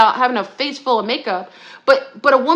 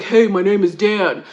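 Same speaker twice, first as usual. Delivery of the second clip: a lightly lowered put-on man's voice; mock man's voice; 4 semitones lower than usual. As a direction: drop the voice a little to play the man. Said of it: "She's like".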